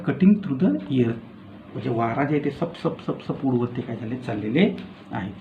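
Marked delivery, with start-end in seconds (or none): 2.8-3.3 soft unvoiced swoosh like rushing wind; whooshing wind sound. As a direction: breathe out a whoosh of air through the teeth. Teacher imitating the wind's whoosh.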